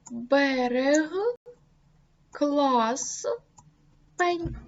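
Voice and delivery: «берег», «клас», «пень» чітко, з притиском на кінцевий приголосний; карбуй кожне слово окремо; closed syllable examples. Shown as a demonstration. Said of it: "Наприклад". (чітка вимова закритих складів)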